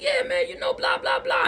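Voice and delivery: very high, excited mock chatter; fawning chatter. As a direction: ham up the strangers gushing. Strangers fawning over friend.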